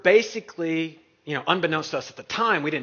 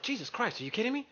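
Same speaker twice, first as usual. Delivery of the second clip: higher, softer mock disbelief; retold disbelief; 7 semitones higher, 9 dB quieter, faster than usual. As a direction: imitate an incredulous aside to yourself. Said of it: "I'm just like".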